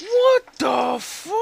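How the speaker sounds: stunned shock. Real, not acted. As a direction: gasp it out in horror, stretching each word. Sees something creepy in game.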